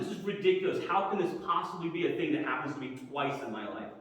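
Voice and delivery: usual speaking voice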